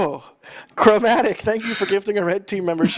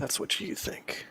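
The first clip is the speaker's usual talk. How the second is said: menacing whisper; 3 semitones lower, 14 dB quieter, slower than usual. Pretend. Joking menace after friends question.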